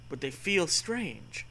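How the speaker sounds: usual speaking voice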